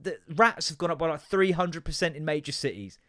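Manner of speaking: ordinary talk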